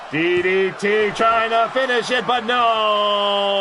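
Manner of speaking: stretched-out final word; play-by-play call. Real, not acted. Announcer calls a failed finishing move, drawn-out. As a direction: call the near finish, holding the last word long.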